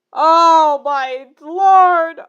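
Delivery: stretched-out wailing cry of disbelief; a long pained wail at what she just read; anguished cry. Real, not acted. Reader wailing at the awful fanfic, long cry. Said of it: "reacting to the fic".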